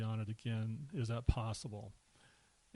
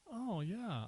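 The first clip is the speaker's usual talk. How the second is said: high swooping "oh" of recognition; high, mildly surprised dawning; retold realization; 10 semitones higher than usual. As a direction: imitate someone only now noticing. Retold people finally noticing the eye.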